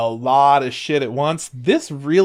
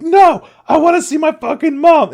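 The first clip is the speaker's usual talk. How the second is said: acted high, loud wail of protest; hammed-up emotional yell; emotional outburst; 9 dB louder than usual. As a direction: cry out like a desperate daughter. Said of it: "So she gets really emotional".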